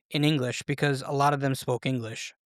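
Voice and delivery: ordinary delivery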